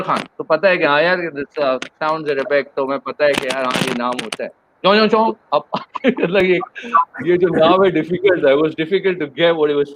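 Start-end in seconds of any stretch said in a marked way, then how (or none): 4.8-5.3 quick mock Chinese syllables, higher and louder; imitating Chinese name. Teacher mocks the hard-to-catch Chinese name, playful imitation. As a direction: chant the name like he heard it. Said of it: "अब वो Chinese है".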